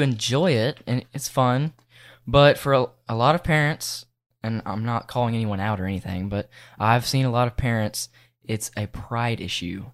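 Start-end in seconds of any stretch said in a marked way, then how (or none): none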